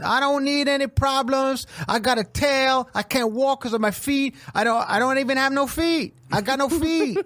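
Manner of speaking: high, put-on confrontational voice; Bahad Bahabi. Imitating her confronting a clam underwater, mock-indignant. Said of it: "So Bahad Bahabi's down there at the bottom of the ocean going".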